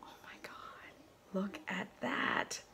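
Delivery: awed slow whisper, almost no voice; breathless whisper. Real, not acted. To viewers while unwrapping a skein, awed whisper. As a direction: hush in wonder at the yarn. Gasping at the yarn colours.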